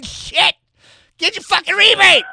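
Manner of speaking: sudden loud mock outburst; shouting. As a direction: blow up over the rebate. Mock outrage at rebate.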